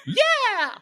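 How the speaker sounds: very high, strained yelp without much volume; imitated scream. Shoresy in the interview with Laura, mock scream. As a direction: let the pent-up urge burst out high. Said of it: "just to scream out".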